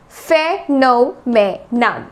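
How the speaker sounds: phenomenon slowly as fe, no, me, nun; four separate beats in phenomenon, ending on n; syllable by syllable, final n. Shown as a demonstration. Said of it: "last में एन आ रहा है".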